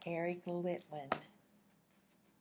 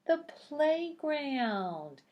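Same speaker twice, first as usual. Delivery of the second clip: higher, louder and slow, drawn out; slow excited reveal; 8 semitones higher, 6 dB louder, slower than usual. Acted. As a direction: stretch the answer like a prize. Reveals answer to young listeners.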